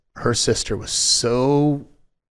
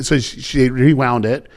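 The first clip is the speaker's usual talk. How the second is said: normal talking voice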